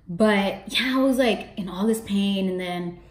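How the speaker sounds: normal talking voice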